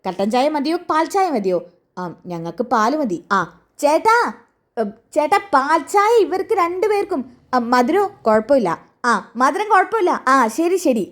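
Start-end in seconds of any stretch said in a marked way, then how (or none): 3.8-7.2 pitched-up call to a half-deaf shopkeeper; calling to deaf man. Acted. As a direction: call it out high so he hears. Newspaper man calls loudly to deaf owner. (ചെവി കേൾക്കാത്തയാളോട് ഉയർന്ന ശബ്ദത്തിൽ വിളിച്ചു പറയുന്നു)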